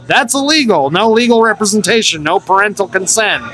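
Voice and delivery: high-pitched retold objection; indignant protest. Teen arguing back at arresting cop.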